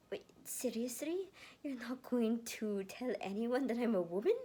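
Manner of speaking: high, loud astonished question; shocked disbelief. Acted. Erica stunned that Lucas keeps her secret, shocked. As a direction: gasp it out in disbelief. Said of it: "in shock".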